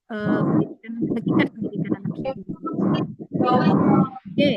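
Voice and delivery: choppy audio, words dropping out; bad connection. Real, not acted. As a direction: voice breaking up over a bad line. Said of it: "Suaranya putus-putus".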